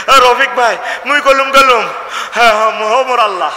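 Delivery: high, loud Barisal blurt; a fainted man's cry. Retold or imitated. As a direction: cry out the dialect as if just waking. Fainted man blurts Barisal dialect. (বরিশালি ভাষায় উঁচু গলায় চেঁচিয়ে ওঠা)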